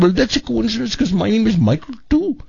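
mimicking the agent's voice. Prank caller claiming the same name, in high mimicking voice. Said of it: "I did the same voice he was doing back to him".

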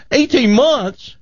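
high, scoffing boastful Texan; bragging Texan. Acted. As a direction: scoff and brag like a big-talking Texan. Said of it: "He goes".

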